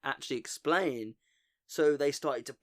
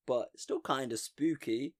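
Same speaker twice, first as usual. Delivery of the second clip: usual speaking voice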